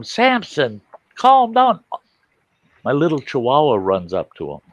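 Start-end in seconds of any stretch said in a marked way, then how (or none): 0.0-1.8 retold yell. Buddy calling his panicking dog to calm down, higher mock yell. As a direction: imitate a frantic owner shouting at his dog. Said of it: "my buddy's yelling at him".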